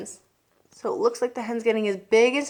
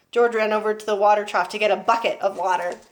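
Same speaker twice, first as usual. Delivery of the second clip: usual speaking voice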